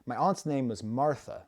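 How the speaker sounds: ordinary talk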